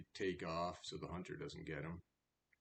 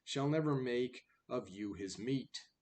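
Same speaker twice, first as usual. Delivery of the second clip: put-on louder, measured recital of old verse; poem reading; 5 semitones higher, 7 dB louder than usual. Reader reciting the fable poem to the class, in full recital voice.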